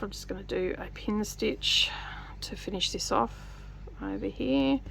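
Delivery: whispered to herself; whispering. Real, not acted. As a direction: murmur it while working. Talking through fiddly stitching.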